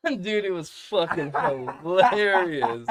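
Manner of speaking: loud, high, stretched through laughter; real laughing. Real, not acted. Dad cracking up at kid's rock, laughing hard. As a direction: crack up while you say it. Cracking up at his own story.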